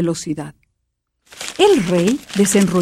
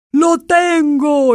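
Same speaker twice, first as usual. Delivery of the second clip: higher, slow triumphant cry; exclaiming king; 7 semitones higher, much slower than usual. A narrator's reading. King showing the spell to queen and princess. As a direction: announce it proudly. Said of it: "exclamó el rey".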